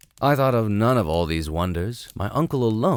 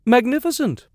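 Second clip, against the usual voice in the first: much higher, louder faked rapture; hammed-up forced awe; imitation of enthusiasm; 5 dB louder than usual. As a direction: gush over it with fake delight. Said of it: "with a good imitation of enthusiasm".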